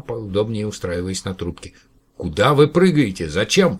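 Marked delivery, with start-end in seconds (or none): 2.2-3.8 louder alarmed questions; horrified. Acted. Professor horrified by the jumping caller, alarmed. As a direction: ask in alarm. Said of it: "профессор был в ужасе".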